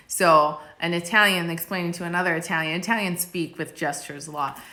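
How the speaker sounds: normal talking voice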